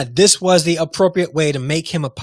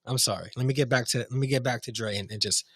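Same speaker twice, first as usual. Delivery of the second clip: much softer, drained after the rant; subdued apology; 5 semitones lower, 10 dB quieter than usual. Real, not acted. Vlogger apologising to viewers after ranting, quiet and drained. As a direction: pull back, quiet and spent, calming down. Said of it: "try to not get emotional".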